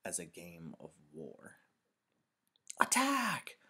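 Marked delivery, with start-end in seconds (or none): 2.8-3.6 high, loud war cry in play; rallying playground holler; battle shout. A narrator's reading. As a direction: yell the charge like a child playing war. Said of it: "The shout came from behind".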